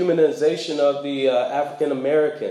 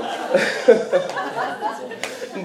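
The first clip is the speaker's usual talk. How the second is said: airy burst of laughter, falling; breathy laugh; 9 semitones higher than usual. Real, not acted. Preacher laughing at his own story about interpreters, airy laugh. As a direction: laugh it off at the memory.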